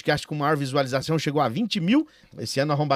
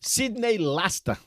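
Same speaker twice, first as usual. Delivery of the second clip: grand entrance announcement; 4 semitones higher, slower than usual. Acted. Host names the guest.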